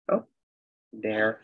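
ordinary talk, nothing marked

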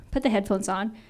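ordinary talk

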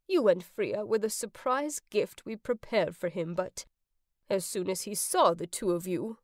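put-on elderly resident. Elderly resident explains Blake's gift.

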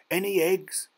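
high, loud question; shouted call. A narrator's reading. Boy shouts from the bank to the wader, calling out. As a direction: call it out across water. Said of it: "Ernie shouted from the bank".

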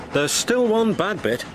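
lightly voiced worried diesel; warning. A narrator's reading. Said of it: "warned Rusty".

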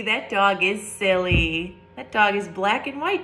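usual speaking voice

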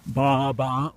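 a light sheep's baa; bleating like a sheep. Pretend. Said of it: "I can try sheep".

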